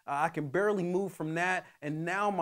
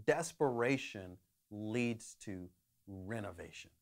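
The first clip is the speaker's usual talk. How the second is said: "desperation leads to renovation" said low and much softer; quiet thesis statement; 7 semitones lower, 7 dB quieter than usual. Shown as a demonstration. Preacher to congregation, first stating the theme: soft and low. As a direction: lay the key line down gently, softer and lower. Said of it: "the one thought that I have for us today is".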